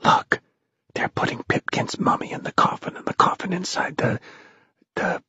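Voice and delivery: urgent soft whisper; hushed, hesitant report. A narrator's reading. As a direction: whisper excitedly, then falter. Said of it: "whispered Tom".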